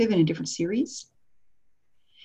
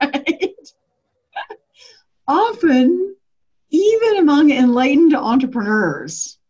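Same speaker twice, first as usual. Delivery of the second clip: ordinary talk, nothing marked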